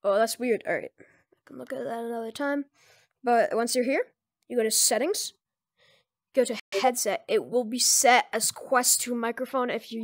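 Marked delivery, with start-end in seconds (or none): none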